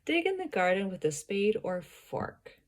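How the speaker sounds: "fork" drops to close the statement; falling end. Shown as a demonstration. Tutor modelling the sentence for a student, falling on "fork". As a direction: bring the voice down on "fork".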